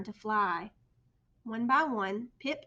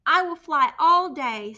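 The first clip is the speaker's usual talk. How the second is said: louder, slow resolve; determined; 5 semitones higher, 13 dB louder, slower than usual. A narrator's reading. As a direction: promise it to yourself firmly. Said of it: "Stellaluna told herself".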